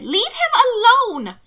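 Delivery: high, loud frightened cry; near shout. Acted. Mina nearly shouting at Hannibal to leave Will, high and loud. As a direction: shout to keep him away. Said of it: "nearly a shout".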